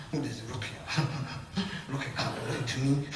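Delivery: soft, low, laughing taunt; mocking jinn laugh. Jinn mocks the reciter, laughing low and soft. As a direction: sneer at the ruqya through quiet laughter. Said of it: "That's how they sort of laughing".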